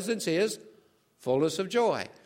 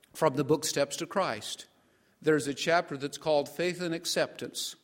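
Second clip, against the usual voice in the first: ordinary delivery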